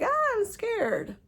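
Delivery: a very high, slow, frightened "ah"; mock frightened shriek; mock scared squeal. Shown as a demonstration. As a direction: squeal it like a scared little scream. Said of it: "for me, screaming is like".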